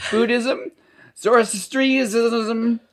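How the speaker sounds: very high-pitched Canadian trailer-park voice; Canadian pikey accent. Acted. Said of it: "article in that accent".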